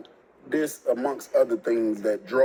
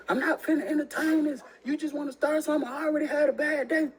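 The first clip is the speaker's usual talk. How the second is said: put-on higher, put-upon girlfriend voice; dismissive girlfriend; 14 semitones higher than usual. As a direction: brush him off, tired of the fight.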